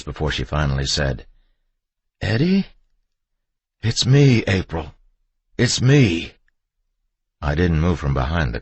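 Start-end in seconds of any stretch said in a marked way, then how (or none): 2.2-2.7 high, tentative question; high, wary woman's voice; uncertain fear. A narrator's reading. Frightened wife doubting it's her husband. 3.8-6.3 raised, high pleading; loud, earnest insistence; insistent reassurance. A narrator's reading. Husband pleading to be recognised.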